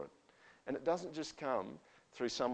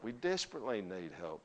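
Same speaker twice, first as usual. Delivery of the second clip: quiet plea; 2 semitones lower, 7 dB quieter than usual. Acted. Preacher drops to plea.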